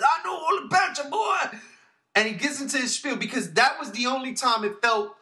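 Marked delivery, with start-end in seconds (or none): 0.0-1.6 mock-shout it like a tough old space pirate; Yondu impression. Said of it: "He says".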